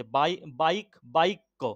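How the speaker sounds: বাইক্য with a clear "bai" before "ko"; i before the conjunct. Shown as a demonstration. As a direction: build up "bai, baik, baikko". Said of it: "হস্যই ধ্বনির উচ্চারণ হচ্ছে".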